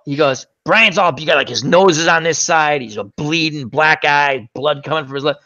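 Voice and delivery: ordinary talk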